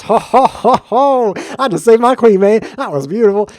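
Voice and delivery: loud, high, jubilant whooping; thrilled outburst, way up high; excited celebrating. Real, not acted. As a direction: crow over a lucky save. Celebrates saving the queen.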